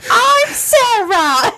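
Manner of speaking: high, slow mock British woman; mock British accent. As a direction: drag out her name in a surly British lady voice. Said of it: "a surly British woman is the voice".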